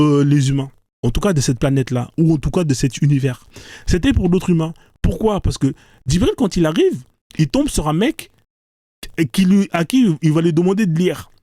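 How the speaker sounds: ordinary talk, nothing marked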